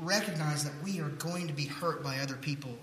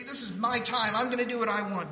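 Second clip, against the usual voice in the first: selfish; 6 semitones higher, 6 dB louder than usual. Retold or imitated. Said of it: "hold on to it and say".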